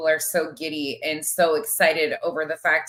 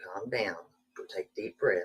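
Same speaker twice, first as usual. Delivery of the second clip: calming down; 8 semitones lower, 11 dB quieter than usual. Real, not acted. Calming down after the argument.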